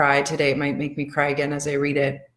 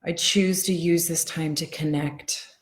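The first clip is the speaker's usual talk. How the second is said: guided affirmations. Acted. Tapping coach guiding her followers through calming affirmations. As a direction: ease them into calm, one line at a time.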